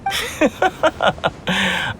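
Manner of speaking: a soft proud laugh; laugh, flattered by praise; pleased laugh. Acted.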